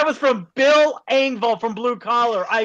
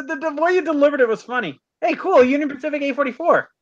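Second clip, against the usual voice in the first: ordinary delivery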